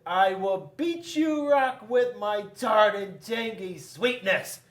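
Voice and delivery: high, loud fruit threat; high boastful apricot. A narrator's reading. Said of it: "And Apricot said".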